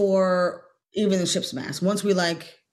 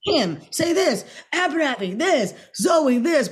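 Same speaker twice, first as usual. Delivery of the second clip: high, loud calling out of lines to each actor; director calling lines; 8 semitones higher, 3 dB louder than usual. Retold or imitated. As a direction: call each name up high, like giving orders from the camera car. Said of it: "In the beginning".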